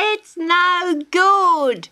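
high, loud cackling drawl; laughing kookaburra. A narrator's reading. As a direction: cackle through the words like a bird. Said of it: "laughed the kookaburra".

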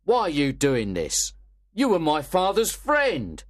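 angry. Acted. Monks to Mister Brownlow, loud angry protest. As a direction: demand an answer, outraged. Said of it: "Monks was very angry and said".

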